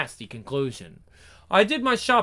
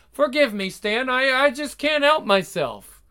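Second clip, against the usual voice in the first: tearful; 7 semitones higher than usual. Acted. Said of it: "I'm going to cry".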